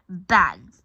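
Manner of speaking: sudden loud storytelling bang. A narrator's reading. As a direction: punch it out like a crash. Sound effect of a fall in the story.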